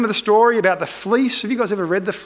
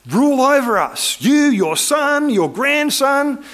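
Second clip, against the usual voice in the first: high, drawn-out acclaim; raised, stretched-out appeal to a leader; crowd's proclamation; 8 semitones higher, 4 dB louder, slower than usual. Retold or imitated. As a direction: proclaim it slowly as an adoring people. People acted pleading with Gideon.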